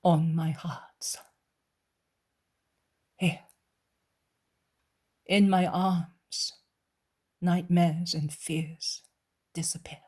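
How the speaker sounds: lightly voiced soothing father; tender comfort. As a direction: soothe a terrified son. Jesus comforts the frightened man.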